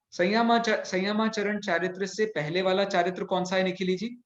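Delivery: normal talking voice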